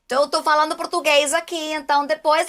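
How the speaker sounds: Portuguese warm-up. Shown as a demonstration. Actor shows how she finds Raze's Brazilian voice. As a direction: talk Portuguese to find the placement. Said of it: "I start speaking Portuguese to myself".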